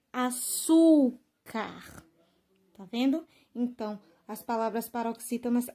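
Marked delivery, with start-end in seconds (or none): 0.1-2.0 açúcar very slow, with a long strong ÇÚ; penultimate stress demo. Shown as a demonstration. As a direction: split açúcar, stretching ÇÚ before CAR. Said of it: "palavras paroxítonas que são terminadas".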